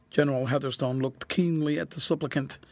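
plain reading voice

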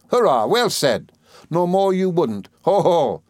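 high, drawn-out hurrah and guffaw; ecstatic cheering. A narrator's reading. Ham applauding David's joke, ecstatic cheer. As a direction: cheer high, ending in a hearty ho-ho. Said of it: "cried Ham in an ecstasy".

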